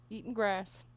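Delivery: ordinary delivery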